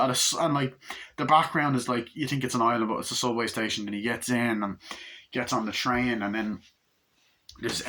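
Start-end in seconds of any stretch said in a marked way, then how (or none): none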